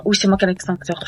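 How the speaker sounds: ordinary talk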